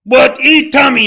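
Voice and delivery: put-on high loud caveman baby talk; mock caveman talk. Mocking the flight attendant by asking Tom like a caveman. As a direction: shout it like talking to a caveman.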